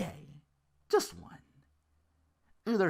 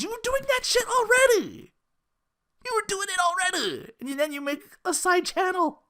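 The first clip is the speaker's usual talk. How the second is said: high, raised exasperated outburst; exasperated rant; 13 semitones higher than usual. Real, not acted. Ranting at the streamer, high and exasperated. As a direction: throw it at him, fed up.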